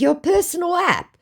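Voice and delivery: high disbelieving echo; incredulous. Retold or imitated. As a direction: repeat it back in mock disbelief.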